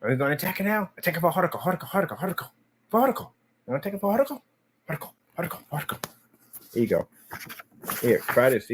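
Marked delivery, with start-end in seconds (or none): 0.0-6.0 pet talk. Real, not acted. Egging on the dog.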